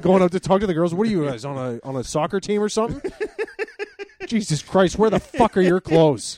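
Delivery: loud, high mock outrage; hammed-up angry father, close to laughing; irate dad. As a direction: holler like an exasperated dad.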